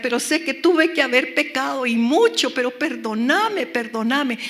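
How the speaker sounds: impassioned imitated begging; retold pleading. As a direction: beg God urgently, re-enacting the prayer. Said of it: "le decía".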